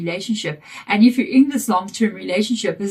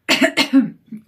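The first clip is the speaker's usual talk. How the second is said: sharp real cough; a quick rough cough; 4 semitones higher, 5 dB louder than usual. Real, not acted. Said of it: "excuse me".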